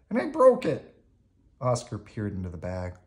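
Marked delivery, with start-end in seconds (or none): 0.1-1.0 high, loud wail; distraught puppet voice, pitched way up; Bert. A narrator's reading. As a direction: wail the confession. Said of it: "he said".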